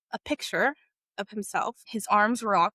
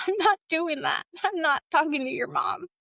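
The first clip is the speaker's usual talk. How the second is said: higher-pitched, retold protest; adamant refusal; 6 semitones higher than usual. Girl refusing to tell boy's mom, adamant refusal. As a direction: imitate digging your heels in, voice up high.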